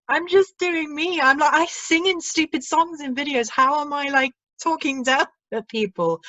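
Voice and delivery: high-pitched, amused mock indignation; incredulous protest. Answering claims of talking down to people, incredulous. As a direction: plead innocence in a high, baffled voice. Protesting being called cold and condescending.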